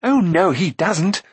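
high-pitched, drawn-out interjection; excited outburst. A narrator's reading. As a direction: burst out with eager contradiction. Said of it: "burst out Rune".

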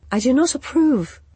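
a touch of cool prim disapproval; Fever's voice. A narrator's reading. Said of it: "she started to say".

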